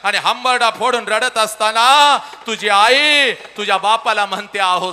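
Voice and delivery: slow, high, loud lament; the mother's loud lament to the father; wailing shout. Acted.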